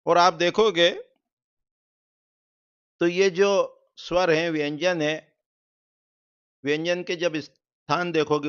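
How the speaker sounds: ordinary talk, nothing marked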